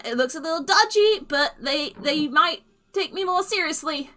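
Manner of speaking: high, loud, breathless gnome babble; high gnome voice, flustered and talking fast; high, rambling gnome. Acted. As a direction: ramble out your excuses in a rush. Gnome explains her plight.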